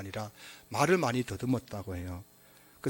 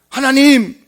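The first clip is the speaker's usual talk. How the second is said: loud high cry of "하나님!"; calling out to God; 11 semitones higher, 17 dB louder, much slower than usual. Pretend. Believer calling out to God, loud high cry. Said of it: "이라고 부르면은".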